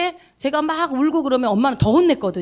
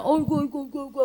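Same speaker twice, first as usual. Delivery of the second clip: imitating a dad's soft cooing; kindhearted dad soothing; 6 dB quieter, faster than usual. Soft-hearted dad comforts his crying child, imitated soothing murmur. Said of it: "아빠는 너무 마음이 좋은 사람이라".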